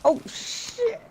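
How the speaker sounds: startled yelp. Real, not acted. Sudden danger in firefight.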